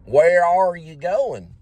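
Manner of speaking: high, slow "Where are" with heavy Texan Rs. Shown as a demonstration. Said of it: "You can just hear it".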